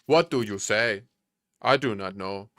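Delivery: a light put-on African accent; lightly voiced African accent, puzzled. Streamer puzzling over the scanner in a light African accent. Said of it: "often use that African accent".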